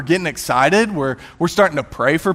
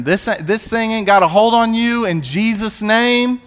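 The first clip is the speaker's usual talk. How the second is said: raised-pitch deliverance prayer, sustained; fervent prayer; 6 semitones higher than usual. Retold or imitated. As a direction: re-enact commanding the thing out in prayer. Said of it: "we're praying, we're believing".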